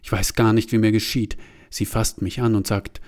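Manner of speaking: usual speaking voice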